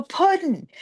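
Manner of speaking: high-pitched character call, drawn out; Harley Quinn. Acted. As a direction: sing out the pet name high, in character. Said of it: "When I go as Harley".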